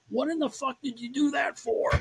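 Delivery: high, incredulous angry outburst; angry career manager. Retold or imitated. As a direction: bark it like an outraged manager on the phone. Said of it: "from this anger".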